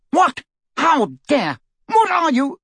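high, loud indignant shriek. A narrator's reading. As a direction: shriek in shocked outrage. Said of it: "shriek with pain".

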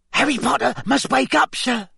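very high, squeaky elf voice; squeaky house-elf. Acted. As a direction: squeak it like a tiny anxious elf. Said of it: "squeaked Dobby".

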